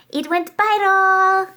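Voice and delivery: high excited exclamation. Real, not acted. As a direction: burst out with the news. Excited viral announcement.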